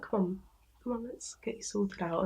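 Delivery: gentle, hushed coaxing; soft coaxing. Retold or imitated. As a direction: softly lead them by the hand. Kindly old lady guiding the dying.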